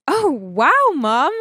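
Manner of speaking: loud, swooping astonished exclamation; startled, sing-song amazement; taken aback. Acted. Acted reaction to mother's sudden offer.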